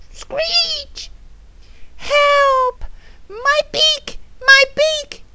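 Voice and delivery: high panicky parrot cries; distressed parrot. Acted. Parrot hurt after a crash, distressed squawks. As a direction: squeal for help like a hurt parrot.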